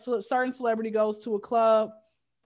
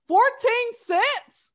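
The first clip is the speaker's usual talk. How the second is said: high, loud, very slow rising Fourteen cents; incredulous disbelief; 12 semitones higher, 5 dB louder, much slower than usual. Retold or imitated. Mocking the celebrity's tiny tip with slow, high disbelief. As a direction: stretch out Fourteen cents in shock.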